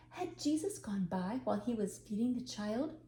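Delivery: ordinary delivery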